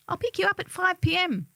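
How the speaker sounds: high, bright friend voice; happy chirp. Retold or imitated. Friend on the phone confirming plans, happily chirping. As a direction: chirp it out cheerfully. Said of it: "my friend happily chirps away".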